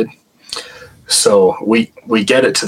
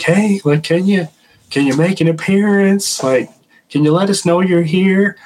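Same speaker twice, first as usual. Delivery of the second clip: high, sweet coaxing voice, imitating Randy; coaxing the spirit; 10 semitones higher than usual. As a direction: gently invite a ghost to show itself, pitched well up. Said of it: "Randy starts talking like".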